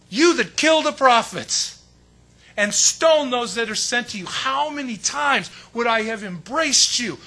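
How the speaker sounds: anguished lament. Acted. Jesus weeping over Jerusalem.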